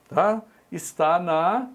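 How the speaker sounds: normal talking voice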